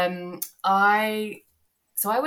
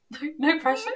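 laughing; much faster than usual. Real, not acted. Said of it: "this one's just for Amy".

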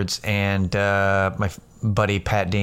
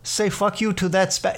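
high-pitched, hesitant mock question; checking in disbelief; 7 semitones higher than usual. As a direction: imitate a puzzled coworker double-checking. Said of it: "Like, he had to clarify".

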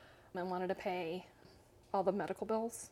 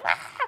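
loud mock scream breaking into laughter; short imitated panic yell with a laugh; freak-out cry; 12 semitones lower, 9 dB louder than usual. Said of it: "I'm, like, freaking out".

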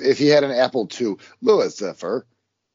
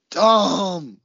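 emphatic; 6 semitones higher, 5 dB louder than usual. Real, not acted. Emphatic repetition.

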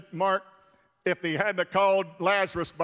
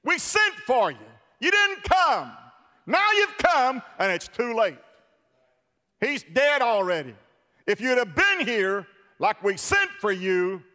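Martha's reproach; 3 semitones higher, 4 dB louder than usual. Acted. Mourner Martha scolds late Jesus.

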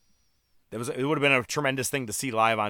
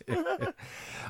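high laugh; 6 dB quieter than usual. Real, not acted. Host laughs at own pun.

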